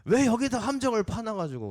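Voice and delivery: acted outrage at the hidden trap; angry shout. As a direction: shout in fury at whoever dug the pit. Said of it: "막 소리치는 거예요".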